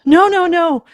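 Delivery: high, loud distraught protest; grief-stricken shout; wailing. Acted. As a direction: wail it in anguish. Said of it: "he wailed".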